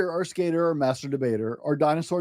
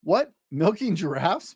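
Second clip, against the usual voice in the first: startled teacher, stretching out the question; Missus Krupp surprised; 3 semitones higher, slower than usual. Acted. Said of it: "Missus Krupp said".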